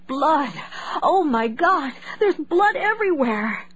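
high, panicked cry. A narrator's reading. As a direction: scream it in terror. Mother sees blood, panics.

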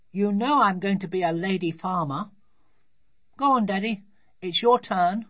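put-on high, loud, insistent child; bright-eyed child pressing daddy to play; eager little daughter. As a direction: play a determined small girl.

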